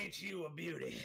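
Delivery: put-on Old Mickey. Voice actor showing off the character's accent, slow backwoods drawl. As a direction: stretch it out in a folksy backwoods accent. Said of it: "Old Mickey's accent is".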